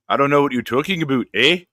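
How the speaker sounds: fast, badly faked Canadian accent; poor Canadian accent. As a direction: toss it off in a cheesy Canadian accent. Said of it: "Nick can do a poor Canadian accent".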